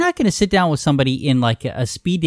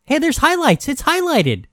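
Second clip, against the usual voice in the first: excited; 12 semitones higher than usual. Real, not acted. Excited joking point.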